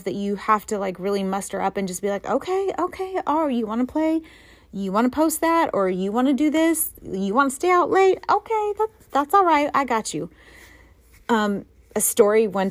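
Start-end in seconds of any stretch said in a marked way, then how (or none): 2.3-10.3 higher, sing-song taunting dare; sweetly menacing, bring it on; mock challenge. Mom taunting her teens who break rules, mock sweet dare. Mom daring kids to misbehave.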